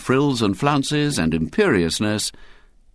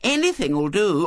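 put-on high impatient little girl; piping small-girl explanation; Violet Elizabeth; 13 semitones higher, slower than usual. A narrator's reading. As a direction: correct him primly with a childish lisp. Little girl explains her pretend game.

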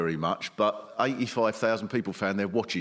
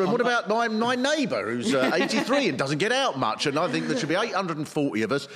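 mock whiny politician; 6 semitones higher than usual. Mock quote of Cameron.

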